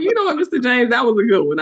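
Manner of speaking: high, loud admiring praise; playful concession. Retold or imitated. Teller praising Mister James's comeback, high and loud. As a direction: hand him the win, grinning.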